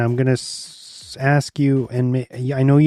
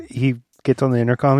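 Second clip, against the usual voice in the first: normal talking voice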